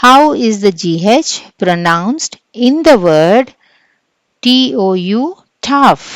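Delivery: loud, slow reading of the question; quiz reading. Acted. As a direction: announce the question to the class. (తరగతికి ప్రశ్న బిగ్గరగా చదవడం)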